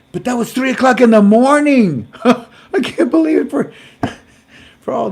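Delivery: loud, high words broken by laughter; cracking up while telling it; laughing. Real, not acted. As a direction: laugh it out in disbelief. Laughs at 3am call story.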